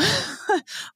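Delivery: short high giggle; quick amused giggle; laugh. Real, not acted. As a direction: let out a soft, high laugh. Laughing while telling story.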